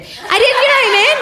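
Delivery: high, loud defensive squeal; defensive squeak. Real, not acted.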